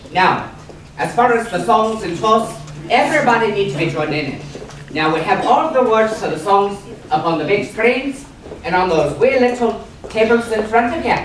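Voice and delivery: normal talking voice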